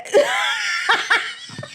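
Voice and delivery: long, high-pitched cackling fit; helpless high laughter. Real, not acted. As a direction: crack up until breath runs out. Cracks up at the meme.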